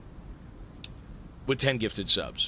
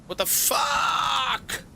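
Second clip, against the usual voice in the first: high, drawn-out disbelieving cry; long high shocked yelp; stunned outcry; 14 semitones higher, much slower than usual. Real, not acted. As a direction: wail it slowly in pure disbelief. More gifted subs mid-sentence.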